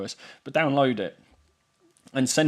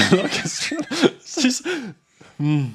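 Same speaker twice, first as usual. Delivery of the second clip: laughing; 12 semitones higher, 6 dB louder, much slower than usual. Real, not acted. Laughing under speech jammer.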